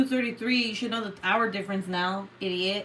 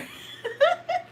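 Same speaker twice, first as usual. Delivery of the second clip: very high burst of laughter; high cackle. Real, not acted. Laughing after embarrassing sister, high cackle. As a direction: cackle at your own joke.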